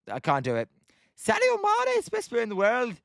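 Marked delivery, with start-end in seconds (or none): 1.2-3.0 squeaky high, badly faked Scouse; Scouse accent. Streamer retries Scouse for Wilson, high hammed-up Scouse accent. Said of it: "that's all I can do".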